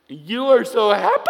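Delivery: shouts it out bright and high; loud, delighted outburst; loud exclamation. Retold or imitated. Old lady calls out in the store aisle, loud and cheery. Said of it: "And she says this".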